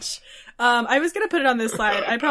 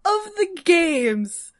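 high, slow, laughing through tears; giggling so hard the voice cracks; laugh-crying; 8 semitones higher, slower than usual. Real, not acted. Laughing-crying about game.

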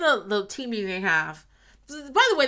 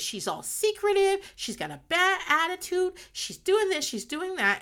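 exasperated mother; 12 semitones higher than usual. Retold or imitated. Amy ranting about her daughter.

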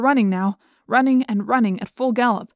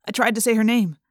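ordinary delivery

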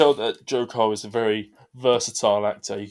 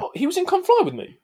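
sudden excitement. Real, not acted. Sudden realization mid-podcast.

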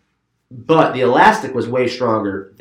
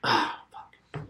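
muttered curse; 12 dB quieter than usual. Retold or imitated. Keith quoted after puking.

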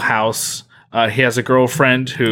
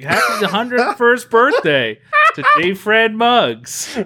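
mock TV announcer; 10 semitones higher, slower than usual. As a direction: belt it like a morning show host. Mock birthday announcement on show.

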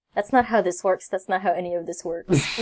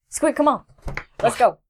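calling the pet; 5 semitones higher than usual. Acted.

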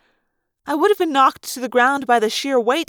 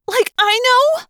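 high-pitched, louder retort; shrill; 12 semitones higher, 5 dB louder than usual. Acted. Rachel answers Cassie's question in a shrill panic. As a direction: snap back high and sharp in panic. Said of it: "I shrilled".

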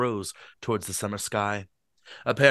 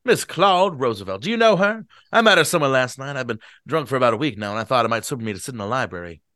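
put-on fast drunk rambling; 5 dB louder, faster than usual. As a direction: babble on cheerfully. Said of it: "give Gatsby a bad voice".

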